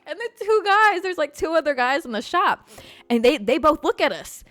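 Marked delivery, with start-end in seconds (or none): none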